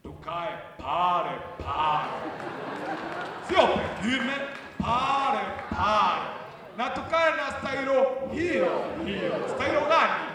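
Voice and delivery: slow, loud presidential imitation; president impression. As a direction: drag out each word like the president. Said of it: "He talks like that".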